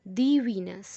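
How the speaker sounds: The said as "thee", Venus stressed; The Venus with a long "ee" in The; "thee" before Venus. Shown as a demonstration. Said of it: "तो क्या बोलेंगे इसको?".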